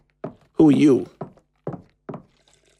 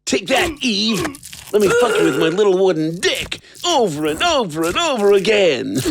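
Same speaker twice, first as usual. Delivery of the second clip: gloating cackle; 6 semitones higher than usual. Acted. Gloating while stabbing with dagger.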